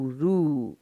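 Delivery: रु with a long, drawn-out "oo"; long vowel. Shown as a demonstration. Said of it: "लंबा वाला".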